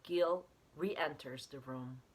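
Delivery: ordinary delivery, nothing marked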